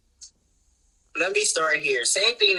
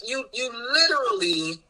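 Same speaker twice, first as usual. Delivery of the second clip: ordinary talk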